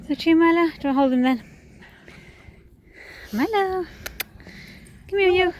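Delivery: high, loud dog-talk. Real, not acted. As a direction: call the dog in happily. Calling the dog excitedly.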